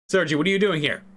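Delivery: ordinary delivery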